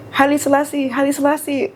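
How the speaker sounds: high-pitched mock dad, repeating the name eagerly; imitating dad's greeting.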